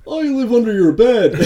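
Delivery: high creepy clown voice with a laugh; a giggly clown taunting the kids; creepy playful under bed whisper. Pretend. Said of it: "Murtilation, the clown".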